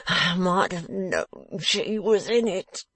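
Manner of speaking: slow, breathy, pained whine; groaning. A narrator's reading. Beaten man realising the lady was involved, groaning. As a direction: moan it bitterly through the pain. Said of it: "groaned Mister Fledgeby, writhing".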